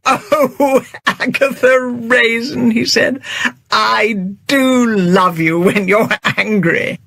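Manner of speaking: teasing fondness through laughter; affectionate. Acted. James to Agatha after laughing, fond.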